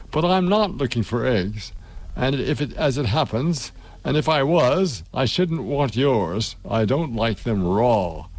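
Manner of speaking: ordinary delivery, nothing marked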